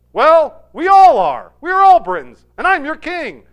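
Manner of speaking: very loud, high mock-royal explanation; emphatic insistence. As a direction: play an exasperated king laying down rank. Arthur insists he is king.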